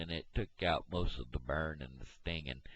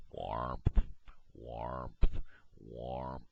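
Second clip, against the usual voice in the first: lower, croaked word like a frog call; 6 semitones lower, much slower than usual. Retold or imitated. Imitating what the frogs say, croaking low. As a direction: croak it like a bullfrog. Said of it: "what them frogs is saying".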